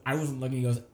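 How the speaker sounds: ordinary talk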